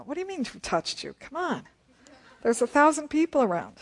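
high-pitched exasperated disciple; Peter. Acted. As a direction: protest as if the question is absurd. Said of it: "that's Peter".

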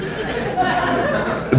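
a quick airy laugh up high; breathy high laugh. Real, not acted. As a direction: let a soft, high laugh slip out. Pastor laughs at his own joke.

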